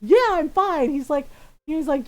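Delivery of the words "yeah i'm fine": a touch louder mock-sarcastic "I'm fine". Grandpa sarcastically answering his daughter after his fall, mildly mocking. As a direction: toss it off with sarcasm, lightly imitated. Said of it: "he says sarcastically".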